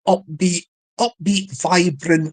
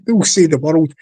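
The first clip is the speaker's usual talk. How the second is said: usual speaking voice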